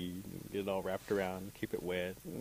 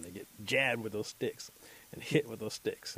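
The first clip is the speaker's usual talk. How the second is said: ordinary delivery, nothing marked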